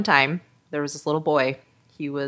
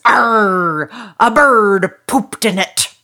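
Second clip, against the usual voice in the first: loud, raised pirate growl; 5 semitones higher, 9 dB louder, slower than usual. Acted. Pirate answering the little boy about his eye patch, booming. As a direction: announce it with a big piratical arr. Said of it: "And the pirate says".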